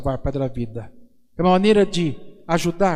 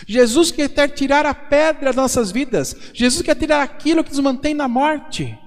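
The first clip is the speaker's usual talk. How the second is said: fervent preaching; 8 semitones higher, 3 dB louder than usual. Acted. Priest proclaiming freedom from the devil's power, impassioned preaching.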